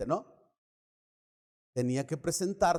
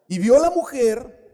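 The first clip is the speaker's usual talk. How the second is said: loud slip; 8 semitones higher, 11 dB louder than usual. Shown as a demonstration. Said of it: "digo, perdón".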